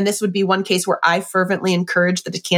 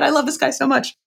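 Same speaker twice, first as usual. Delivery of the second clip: ordinary talk, nothing marked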